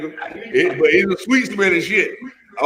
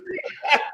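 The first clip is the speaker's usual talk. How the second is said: laughter burst; 10 semitones higher, 7 dB quieter than usual. Real, not acted.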